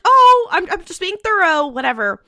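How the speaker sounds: high, loud, squeaky mock innocence; Dean's excuse. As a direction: play it falsely innocent. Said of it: "And he's like".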